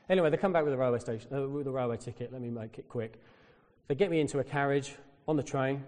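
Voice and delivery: normal talking voice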